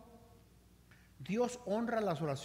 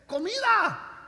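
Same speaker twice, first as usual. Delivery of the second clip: exasperated shout; 11 semitones higher, 9 dB louder, slower than usual. Acted. Starving guest answers obvious question.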